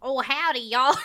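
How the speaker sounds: put-on comic Southern-accented giant; fake Southern accent. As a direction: greet them like a fake Southerner. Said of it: "That's my Giant accent".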